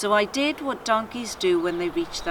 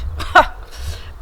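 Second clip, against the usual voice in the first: snort; 4 dB louder than usual. Acted. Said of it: "And she snorted".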